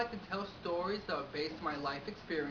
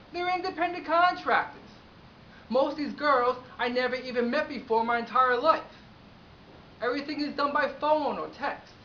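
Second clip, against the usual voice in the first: loud, raised-pitch outrage; indignant rant; 5 semitones higher, 7 dB louder than usual. Acted. Agency owner to client asking about his girls, indignant. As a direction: lay into the caller in disbelief.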